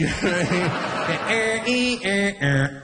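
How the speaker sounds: slow sung sequence of tones; imitated alien tones. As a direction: intone notes like a spaceship signal. Imitates alien tones after film pun.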